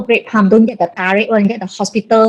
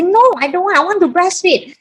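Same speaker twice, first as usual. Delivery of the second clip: high-pitched retold protest; insistent refusal; 9 semitones higher than usual. Wife turning down her husband's medicine idea, insistent. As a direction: replay digging your heels in, pitched well up.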